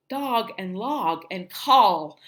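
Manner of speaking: "Dog", "log", "call" slowly, each with open ah; standard vowel. Shown as a demonstration. Teacher modelling correct words, slow open ah in "Dog", "log", "call". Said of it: "pronounce things correctly".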